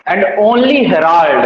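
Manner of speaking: very slow, weight on only; slow verse recital. Shown as a demonstration. Teacher reciting the sonnet line to students, very slowly. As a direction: read the line out slowly, word by word.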